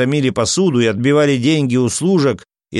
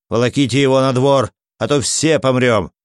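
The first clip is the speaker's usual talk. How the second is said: ordinary delivery, nothing marked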